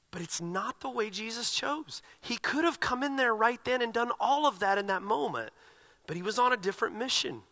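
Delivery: ordinary talk, nothing marked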